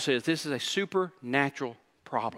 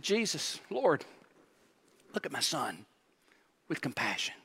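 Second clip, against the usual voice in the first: broken plea; 8 dB quieter than usual. Acted. Desperate father, voice drops.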